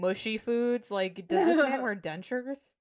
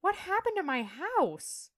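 high, slow character impression; Sheila; 10 semitones higher than usual. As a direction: draw it out high, mimicking her dismay. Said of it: "she goes".

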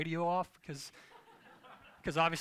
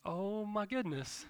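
slow, higher-pitched dread; acted dismay; 4 semitones higher, much slower than usual. Driver sees eleven miles to empty, slow dismay. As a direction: draw it out in mock dismay. Reacting to low fuel warning.

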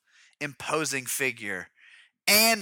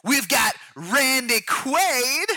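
high, slow, stretched announcer hype; mock promo announcer; 10 semitones higher, 4 dB louder, much slower than usual. Announcer introducing the next star, hyped promo voice. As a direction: draw the name out like a trailer.

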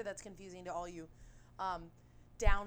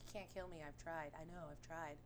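quoted confession; 5 semitones lower, 12 dB quieter than usual. Retold or imitated. Quotes Hulk's sad confession.

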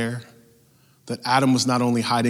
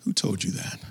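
quiet question; 5 semitones lower, 9 dB quieter, faster than usual. Acted. Question after climax, dropped low.

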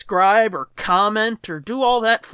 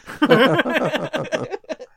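laughing; 5 semitones higher than usual. Real, not acted. Laughing at the bit.

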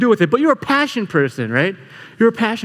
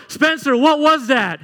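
yelling up; 7 semitones higher, slower than usual. Retold or imitated. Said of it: "So I yell up".

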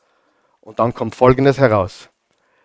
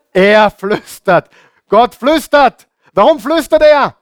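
raised, ringing preacher's call; emphatic proclamation; 8 semitones higher, 4 dB louder than usual. Real, not acted. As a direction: declaim it high, each phrase climbing. Preacher proclaims the key point.

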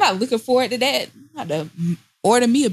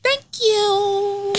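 sing-song thanks; 12 semitones higher than usual. Pretend. Ad sign-off.

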